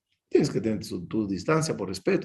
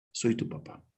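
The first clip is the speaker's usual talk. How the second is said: tender father voice; 3 semitones lower, 10 dB quieter than usual. Retold or imitated. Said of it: "dice Hashem".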